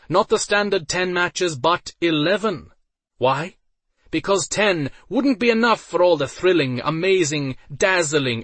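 loud, high, building hype list; excited announcer. A narrator's reading. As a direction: pump up the crowd. Announcer hyping the crowd.

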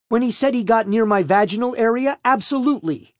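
high, heated insistence; indignant retort. A narrator's reading. Defendant standing by her accusation to prosecutor, indignant. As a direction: insist on it, pitched well up. Defendant angrily doubles down under questioning.

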